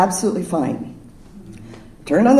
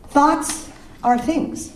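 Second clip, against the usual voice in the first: slow, weighty; 5 semitones higher, much slower than usual. Real, not acted. Slow emphatic sermon statement.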